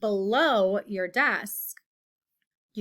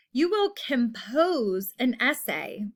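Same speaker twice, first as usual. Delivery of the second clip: usual speaking voice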